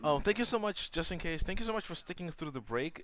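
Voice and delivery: excited thanks. Real, not acted. Stream host greeting viewers.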